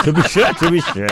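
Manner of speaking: laughing mock-Irish lilt; mock Irish accent. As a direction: echo the Irish attempt, amused and high. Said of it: "And Irish?".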